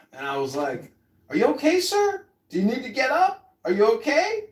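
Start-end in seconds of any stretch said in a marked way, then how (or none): 1.3-4.5 high concerned voice. Retold or imitated. Himself asking the fallen man.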